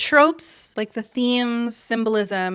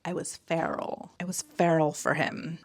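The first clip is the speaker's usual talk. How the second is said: a hint of a swooning heroine, much softer; mock sultry heroine; 3 semitones lower, 7 dB quieter than usual. Mocking heroines' animal talk about men, mock sultry. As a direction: breathe it like a lovesick romance lead.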